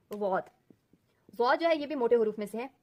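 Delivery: ordinary delivery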